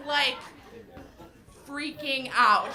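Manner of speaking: ordinary talk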